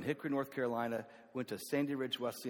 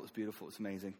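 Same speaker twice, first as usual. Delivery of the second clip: ordinary talk, nothing marked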